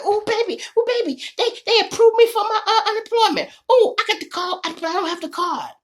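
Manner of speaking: very high, squealing with giggles; excited wife. Retold or imitated. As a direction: gush like she just got good news. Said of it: "She come in there all excited".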